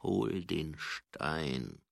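very slow, low growled order. A narrator's reading. Angua ordering the baroness to fetch the stone, low growl. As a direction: growl it out with menace. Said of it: "knurrte Angua".

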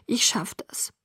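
soft, whispery tearful reply; hushed, holding back tears; sniffling. A narrator's reading. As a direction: say it weakly through sniffles. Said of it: "sagte ich schniefend".

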